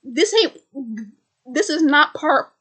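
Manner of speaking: loud, high hold on now; mock panicked objection; alarmed protest. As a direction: stop them in a hurry, rattled. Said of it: "Jimmy's like".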